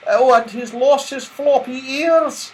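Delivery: wistful but amused; Silky voice. A narrator's reading. Silky noticing Saucepan's lost ears, fond regret. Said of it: "said Silky".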